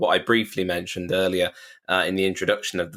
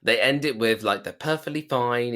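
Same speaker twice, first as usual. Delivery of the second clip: ordinary talk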